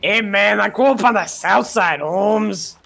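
hammed-up Chicano street tough. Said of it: "I lost the accent a bit".

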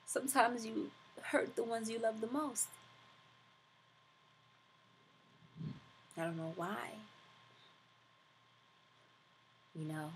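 cheating mother says sorry to her family, soft and broken; remorseful spouse apologizing. Acted. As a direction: confess it quietly, ashamed and worn down. Performs the cold-read monologue.

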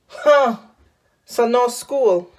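ordinary delivery

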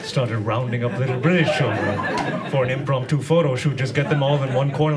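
normal talking voice

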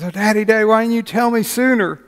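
put-on high, loud, thrilled little girl's cry; excited little girl. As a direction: squeal it like a child opening a present. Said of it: "And she's excited, and she's jumping around".

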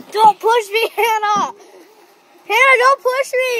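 loud. Real, not acted. Kid yelling at sister.

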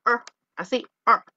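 short isolated "er" from the throat; throat vowel sound. Shown as a demonstration. Teacher demonstrates phonetics, "er" as a throat sound. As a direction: give "er" as a bare vowel made in the throat. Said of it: "Se escucha bien ridículo, pero así es".

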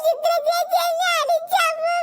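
slow, drawn-out tattling wail; childish crying. Acted. Child telling mother that Chitra hit her, wailing. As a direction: wail it out, stretching the last word. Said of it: "ഒന്ന് കരയാതെ മോളെ".

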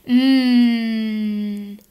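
tongue tip up behind the teeth, a held N; N sound. Shown as a demonstration. Teacher modeling the N sound for kids, a held front N. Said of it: "Everybody say".

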